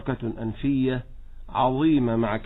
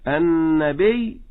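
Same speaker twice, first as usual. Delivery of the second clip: النبي stressed on the ba, very slow and rising; 4 dB louder, much slower than usual. Shown as a demonstration. Teacher gives the correct النبي slowly. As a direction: draw out النبي, leaning on the ba. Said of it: "هذه هي الصحيحة".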